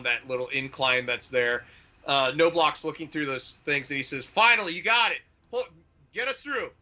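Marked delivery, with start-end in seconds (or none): 4.2-6.7 higher-pitched urgent call to the crew; calling out in relief. Acted. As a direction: call out the order, pitch jumping high. Tank crewman calling out orders.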